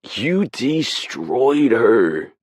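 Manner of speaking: high, loud, very slow crowing; gleeful jeer. Acted. Novi jeers in triumph at Rachel, loud. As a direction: crow over the win. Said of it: "Novi jeers".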